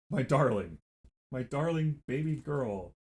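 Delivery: soft slow cooing to a pet; tender pet talk to a yelling cat. Real, not acted. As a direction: croon to the cat, slowly and softly.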